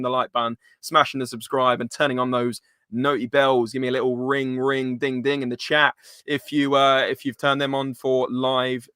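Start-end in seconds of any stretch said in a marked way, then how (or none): none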